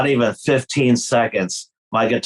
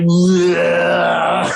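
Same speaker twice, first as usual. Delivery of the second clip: disgusted bleh; 5 semitones higher than usual. Retold or imitated. Guitarist wiping beer towel on his face, gagging bleh. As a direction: spit out a gagging noise of disgust.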